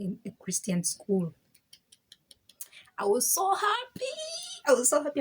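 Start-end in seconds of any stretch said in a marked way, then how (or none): 3.0-4.1 squealing much higher with joy; excited squeal. Real, not acted. Joy at new school. 4.7-5.2 louder, higher happy outburst; loud excited. Real, not acted. Joy at new school.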